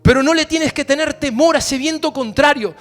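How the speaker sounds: rally the church against the wind; preaching at full force. Acted.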